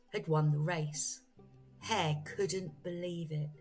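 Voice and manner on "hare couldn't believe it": plain reading voice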